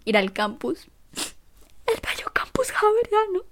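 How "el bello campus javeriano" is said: high, whispered fake sob; mock weeping. Missing her old campus, hammed-up tearful whisper. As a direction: whimper it like a melodramatic crybaby.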